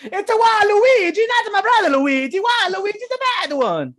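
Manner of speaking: high, loud cartoon Italian; terrible Italian accent. Gamer naming the villain, loud hammed-up Italian accent. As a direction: squeal a warning in fake Italian. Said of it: "this terrible Italian accent".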